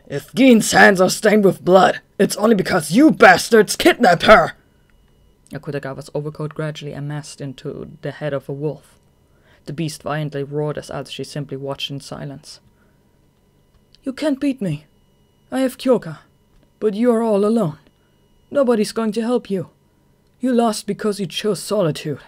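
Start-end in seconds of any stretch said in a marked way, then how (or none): 0.1-4.5 loud, slow, seething rage; furious yelling. Acted. Akutagawa enraged over his sister. 14.0-22.2 higher, loud, resolute; confident hero. Acted. Atsushi confronts Akutagawa.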